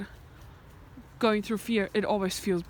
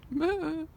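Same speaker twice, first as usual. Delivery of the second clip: higher-pitched farewell "meh"; high sheep call of goodbye; goodbye sheep bleat; 6 semitones higher than usual. Retold or imitated. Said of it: "That means goodbye".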